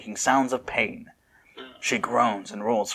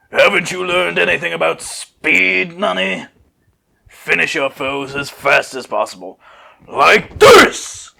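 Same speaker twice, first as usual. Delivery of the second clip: bold, louder character voice; proud teacher voice, rising to a shout; Sissel's boast; 6 semitones higher, 7 dB louder, slower than usual. Acted. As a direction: lecture her like a cocky rival. Sissel lectures Nani in character.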